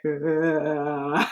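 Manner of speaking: low, soft whale moan from Dory; low whale-call hum; whale sound hum. Retold or imitated. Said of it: "doing the whale sound from Door".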